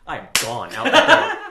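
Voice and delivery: ordinary delivery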